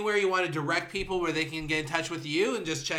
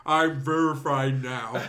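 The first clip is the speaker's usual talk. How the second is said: giant voice; 4 semitones lower, slower than usual. Retold or imitated. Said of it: "Andre the Giant of Twitter eggs".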